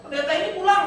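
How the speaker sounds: high, bossy order to the driver; pastor's wife giving orders. Retold or imitated. Pastor's wife orders her driver to take the preacher home, high and bossy. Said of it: "Ibu Gembala ngomong gini".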